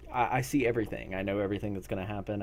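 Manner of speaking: ordinary talk, nothing marked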